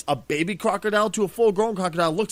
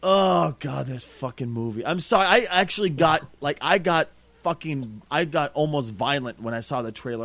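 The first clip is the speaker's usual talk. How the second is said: usual speaking voice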